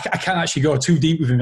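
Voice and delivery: usual speaking voice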